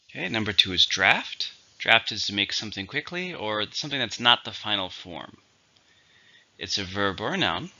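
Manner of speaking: ordinary talk, nothing marked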